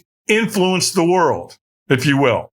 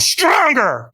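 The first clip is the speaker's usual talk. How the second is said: higher, pushed command; firm demand; 8 semitones higher than usual. Retold or imitated. The trainer demands a stronger yes, pushed command. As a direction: urge her to push harder.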